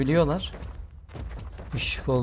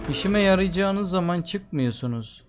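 annoyed scolding; 5 semitones higher than usual. Real, not acted. Scolding the monsters.

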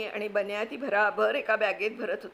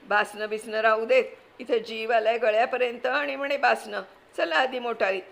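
frantic aunt; 2 semitones higher, 4 dB louder than usual. Acted. Wife panicking at husband as flood comes, frantic scolding. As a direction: snap at the husband while grabbing valuables. Kaku dismisses husband's papers.

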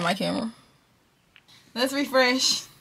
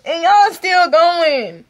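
loud, high, drawn-out tearful disbelief; crying with joy; 7 semitones higher, 9 dB louder, slower than usual. Real, not acted. Streamer watching subscribers keep coming, joyful crying wail. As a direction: wail it out, overwhelmed and stretching the words. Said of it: "I'm crying".

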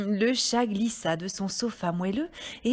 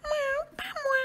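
very high, thin mew; cat voice. A narrator's reading. Cat refusing to help the hen, high mewing voice. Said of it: "dit le chat".

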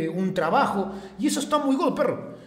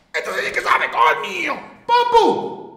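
sudden loud, much higher yell; excited shout; 10 semitones higher, 6 dB louder than usual. Real, not acted. Streamer shouting to his chat, loud and excited. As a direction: burst out with a cry of delight.

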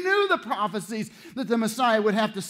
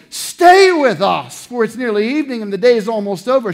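urgent plea; 2 semitones higher, 10 dB louder than usual. Acted. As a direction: beg him to stay. Disciples urged him strongly.

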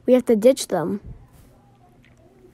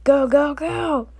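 urgent shout; 4 semitones higher, slower than usual. Acted. Urging escape.

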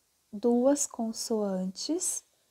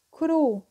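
"cru" said out clearly and louder, as an example; showing the word; 4 semitones higher, 5 dB louder than usual. Shown as a demonstration. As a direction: hold up "cru" for the class to hear. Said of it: "aqui, ó". ("cru" pronunciado com destaque)

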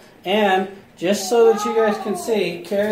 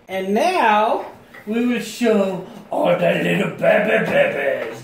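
normal talking voice

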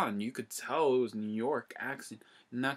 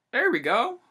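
high, loud victorious shout; triumphant; 13 semitones higher, 11 dB louder than usual. Pretend. Pretending to shoot webs, a high triumphant shout. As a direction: crow it out high like a hero.

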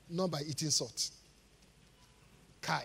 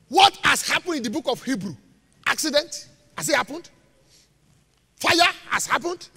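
loud, raised-pitch rhetorical questions; forceful preaching; 7 semitones higher, 10 dB louder than usual. Real, not acted. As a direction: challenge the congregation, question after question.

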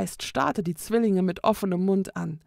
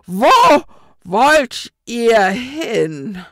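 very slow, loud, menacing ghostly demand; looming ghost; 4 semitones higher, 11 dB louder, much slower than usual. A narrator's reading. Ghost shoots up and stops the fleeing twins, slow, loud, menacing. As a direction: tower over them and boom the question.

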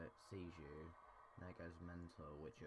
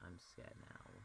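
scared whisper; 7 dB quieter than usual. Real, not acted. Whispered after laugh.

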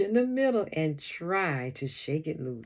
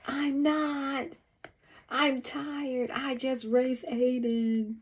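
calling back; 8 semitones higher, slower than usual. A narrator's reading. Brother calls back during the race.

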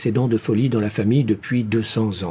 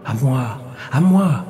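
ringing cry. A narrator's reading. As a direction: let the second cry ring out. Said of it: "cria une voix retentissante".

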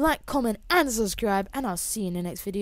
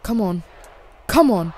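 usual speaking voice

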